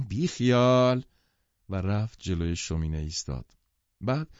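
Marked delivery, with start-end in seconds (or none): 0.1-1.1 raised, carefree exclamation; loud. A narrator's reading. Daisy brushing off her friend's scolding, out loud. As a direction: call it out loudly and higher. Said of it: "دیزی با صدای بلند گفت".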